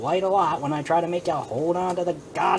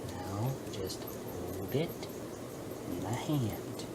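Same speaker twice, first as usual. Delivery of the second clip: hushed coaxing; 8 semitones lower, 14 dB quieter, slower than usual. Real, not acted. Delicate fiddling, hushed.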